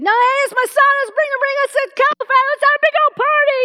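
loud, very high celebratory holler; overjoyed shouting. Acted. Father announcing a feast for his son, exultant. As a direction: holler for a party at the top of your voice.

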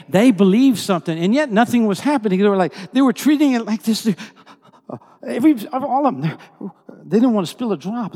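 high, loud preaching at full force; impassioned preaching. Real, not acted. Preacher marvels at the men's faith, impassioned and loud. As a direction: belt out the amazement to the room.

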